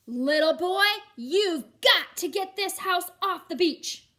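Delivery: loud slow high telling-off; scolding judge. Acted. Judge ordering Matthew off the beach, loud. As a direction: scold the little boy.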